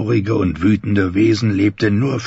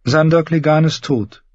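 higher character voice, mildly downcast; dejected; 8 semitones higher than usual. Acted. Brienne concluding that Sandor Clegane is dead, mildly dejected. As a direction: let a little dejection into the raised voice. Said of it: "meinte sie niedergeschlagen".

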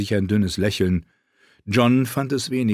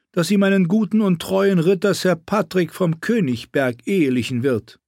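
evil queen; 9 semitones higher than usual. Acted. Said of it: "schniefte Königin Selyse".

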